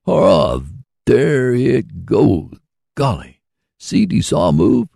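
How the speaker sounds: high, happy shouts of delight; a man thrilled his sawmill runs, crying out high and delighted. A narrator's reading. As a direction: cry out with joy as the saw starts. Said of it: "cried the delighted man".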